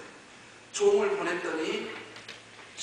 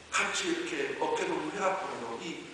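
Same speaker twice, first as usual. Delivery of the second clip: normal talking voice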